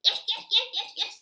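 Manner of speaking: high, loud eager yeses; eager puppy. Acted. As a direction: gush out the yeses like an excited pup. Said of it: "said Spotty".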